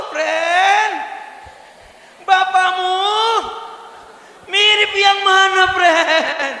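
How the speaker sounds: high, loud shouted ask to your neighbour; shouting playfully at the neighbour; loud question. Shown as a demonstration. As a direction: call it out across the pew. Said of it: "Tanya dulu".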